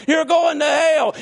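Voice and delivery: slow, drawn-out hellfire shout, high and loud; imitating a shouting preacher. Mimicking the hellfire preacher to the church, slow shout. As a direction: bellow each word like a damning preacher. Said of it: "And for twenty minutes".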